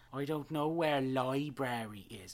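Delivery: hammed-up bad Irish accent, stretched slowly; terrible Irish accent. Host mocking the redhead's line about the library, terrible Irish accent. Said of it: "she has this horrible way of saying".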